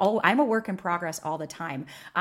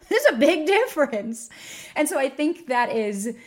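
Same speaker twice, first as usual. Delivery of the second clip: laughing; 7 semitones higher, 5 dB louder than usual. Real, not acted. Laughs after the insult quote.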